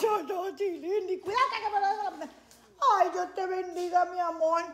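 high cooing mother. Real, not acted. Talks tenderly to child.

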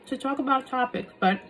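chewing while talking; talking between bites; mouth full. Real, not acted. Seen 0: Bites melon at 4.8, then chews with full mouth while talking.

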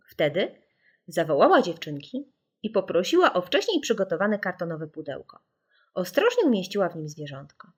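ordinary delivery

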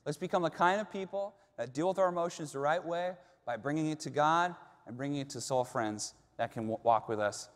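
rally the congregation like a preacher; preaching. Acted. Preaching climax.

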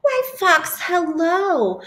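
high, bright squeaky greeting; cheery mouse. Acted. Mouse greets Snake in a high cheerful squeak. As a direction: greet your friend sweetly.

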